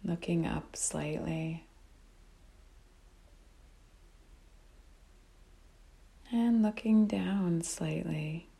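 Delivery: calm, unhurried meditation guide with long pauses; guided meditation. Real, not acted. Teacher guiding listeners to notice breath and body, calm meditation voice. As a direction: guide them gently, phrase by phrase, leaving long silences.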